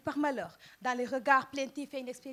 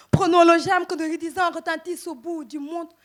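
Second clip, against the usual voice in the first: loud, higher, declaimed call to all; rallying cry; 4 semitones higher, 12 dB louder than usual. Acted. Poet calling brothers and sisters to struggle, loud rallying cry. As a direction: burst out as if rousing a crowd.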